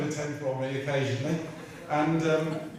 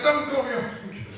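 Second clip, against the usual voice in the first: higher mocking voice of the angry boss; mock angry order; 11 semitones higher, 3 dB louder than usual. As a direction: parody his fury. Angry official retold, mock voice.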